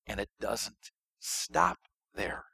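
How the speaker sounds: slow, hushed whisper. Real, not acted. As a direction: lean in and whisper the secret. Teasing a bigger reveal.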